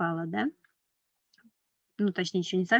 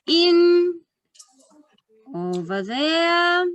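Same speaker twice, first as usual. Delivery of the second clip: put-on reading to a toddler; 5 semitones higher, slower than usual. Reads picture book to children.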